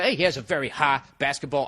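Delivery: ordinary delivery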